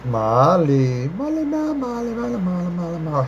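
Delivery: playful repeated calling of a name; sing-song calling. Real, not acted. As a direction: call Molly in a teasing singsong, fading out. Calling a viewer to the front.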